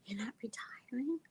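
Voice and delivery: high, soft, incredulous; hushed, high-pitched imagined question; retold inner question. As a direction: wonder it privately in a small high voice. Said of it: "in my mind I'm going".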